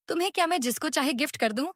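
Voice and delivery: quick, high, dismissive retort; with attitude. A narrator's reading. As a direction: brush off the shopkeeper sharply and fast. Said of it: "Xiao attitude से कहती है". (तेज़, ऊँची आवाज़ में attitude से)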